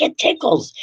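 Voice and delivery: mock giggly high voice; giggly daughter. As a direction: squeak it out like a ticklish kid laughing. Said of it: "busts out in laughter".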